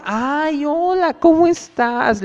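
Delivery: high, drawn-out sugary hello; gushing greeting. Retold or imitated. Friend greeting a pal, high gushing hello. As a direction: coo the hello like an overjoyed friend.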